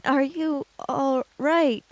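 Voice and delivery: normal talking voice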